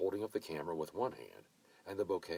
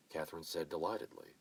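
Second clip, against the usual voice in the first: ordinary delivery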